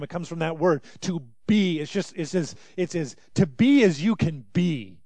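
ordinary talk

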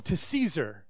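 ordinary delivery

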